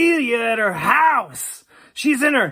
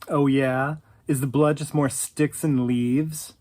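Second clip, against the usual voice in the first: put-on sarcastic kid; 12 semitones lower, 4 dB quieter than usual. Kid's sarcastic reply retold.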